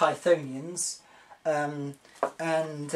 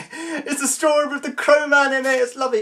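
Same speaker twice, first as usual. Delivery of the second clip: higher, laughing through the words; giddy laughing delight; 8 semitones higher, 3 dB louder than usual. Retold or imitated. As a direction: gush with childish glee. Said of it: "And I'm like".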